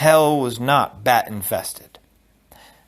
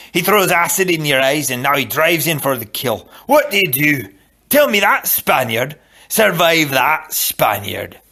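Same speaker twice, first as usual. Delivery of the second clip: goading Scottish fencing master, building louder; Macpherson; 3 semitones higher, 4 dB louder than usual. A narrator's reading. Fencing master testing his Spanish pupil, Scottish accent. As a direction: challenge the pupil in a forceful Scots brogue. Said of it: "the only Scot who ever understood swords".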